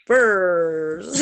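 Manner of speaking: Burrs with a low rolled Berkshire r; low drawn Berkshire Burrs. Shown as a demonstration. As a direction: growl out Burrs in a Berkshire burr. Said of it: "Berkshire's got the burr".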